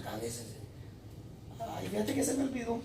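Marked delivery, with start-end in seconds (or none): 1.6-2.8 high, soft, sheepish mock voice; creyente olvidadizo imitado. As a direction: act out a hushed, high-voiced forgetful believer. Voices forgetful believer before Christ. (vocecita aguda y apenada, imitando)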